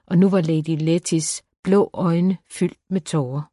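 usual speaking voice

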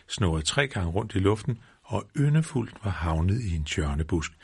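ordinary delivery

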